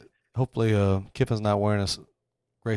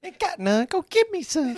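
Jimbo juice voice. Retold or imitated. Joining Jimbo impression.

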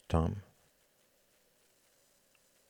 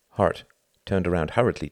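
ordinary delivery

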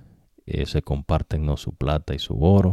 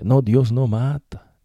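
higher, slow, drawn-out mock denial; mocking the devil's voice; 8 semitones higher, 5 dB louder, slower than usual. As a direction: mimic the tempter's sweet lie. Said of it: "esa es la voz del diablo".

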